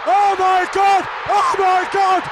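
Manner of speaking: ordinary delivery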